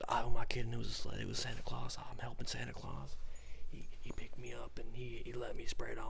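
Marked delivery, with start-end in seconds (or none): none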